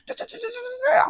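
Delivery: high da-da babble; mocking babble. Brushing off the man's lecture, high mock babble. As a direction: parody his lecture as nonsense.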